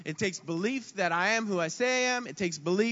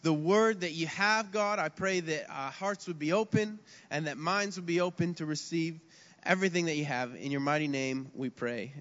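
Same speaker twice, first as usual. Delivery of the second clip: prayer; 4 semitones lower, 4 dB quieter than usual. Acted. Closing prayer before sermon.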